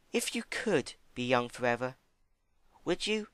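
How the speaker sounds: timid Fluttershy. Acted. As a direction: ask it carefully, shyly. Fluttershy voiced.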